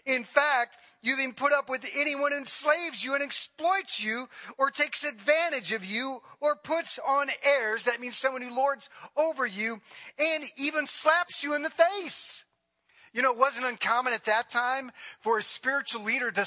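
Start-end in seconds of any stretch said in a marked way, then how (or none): 0.1-7.8 raised, emphatic sarcastic reading; high-pitched, pointed sarcasm. Retold or imitated. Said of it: "I want you to hear the sarcasm". 10.2-12.4 high, incredulous sarcasm, slowing at the end; pitched-up, pointed sarcastic punch. Retold or imitated. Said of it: "I want you to hear the sarcasm".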